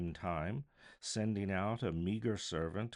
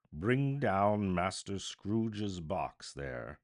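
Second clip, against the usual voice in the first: loud, slow, booming command; terrible voice crying out; 7 dB louder, slower than usual. A narrator's reading. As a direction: bellow it down the hall like a stern master. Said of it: "A terrible voice in the hall cried".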